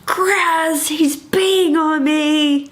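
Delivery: high, slow alarmed call for help; mock panicked call to her husband; alarmed cry. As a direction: shriek it out as the pee hits. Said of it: "and I was like".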